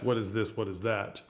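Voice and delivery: flat, grabby questions; nosy old biddies. Shown as a demonstration. Showing the nosy biddy version, low and flat. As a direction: poke through her stuff like a nosy aunt. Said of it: "it's funnier to have them go".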